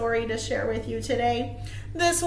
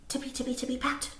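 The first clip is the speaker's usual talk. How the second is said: soft whisper of tiptoeing bunnies; whispered sneaking; 11 dB quieter, much faster than usual. A narrator's reading. Reading bunny footsteps to children in a whisper.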